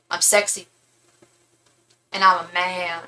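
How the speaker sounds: lightly voiced deadpan star, long pause between phrases. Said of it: "she did [name]".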